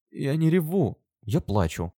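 put-on high, hurt little-girl voice; sulky child's protest; offended little girl. A narrator's reading. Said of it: "голосом обиженной девочки".

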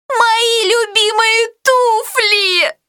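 high, slow wailing owner; dismayed cry. A narrator's reading. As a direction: cry out over ruined shoes. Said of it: "вскричала Сюзанна".

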